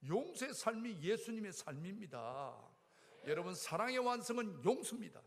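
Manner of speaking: imitating a preacher in full flow; preaching. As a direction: preach it to the congregation, rising. Quoting the revival preacher's message.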